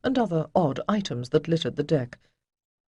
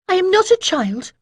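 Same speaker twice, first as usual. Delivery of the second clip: higher, louder sharp retort; sharp interruption; 8 dB louder than usual. A narrator's reading. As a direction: cut him off sharply. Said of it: "Ariel interrupted a little sharply".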